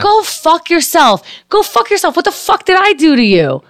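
rising, heated go-to-hell; rising angry dismissal; angry outburst. Real, not acted. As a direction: tell the troll off, pitch climbing. Venting at forum troll.

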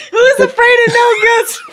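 laughing through the quote. Acted. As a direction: giggle the line out. Laughing mock quote of Ghostbusters.

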